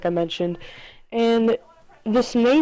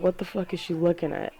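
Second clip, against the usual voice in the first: muttered under breath; 2 semitones lower, 7 dB quieter than usual. Retold or imitated. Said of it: "We didn't say it out loud".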